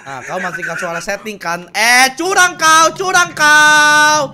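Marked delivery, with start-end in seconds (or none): 1.7-4.3 loud, high, drawn-out accusation; high, slow yell of protest; outraged shout. Real, not acted. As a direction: cry foul at full volume, stretching the words. Accusing the other player of cheating.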